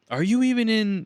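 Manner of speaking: high, loud screeching rant; mock furious caller. Caller furious there is no manager, loud screeching. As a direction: blow up at the agent.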